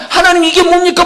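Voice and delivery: "이게" cried out high and loud, pleading; loud pleading prayer. Acted. Pastor voicing a believer crying out to God, high and loud. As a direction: call out "하나님, 이게 뭡니까?" high and loud like a desperate plea. Acted prayer at full preaching force.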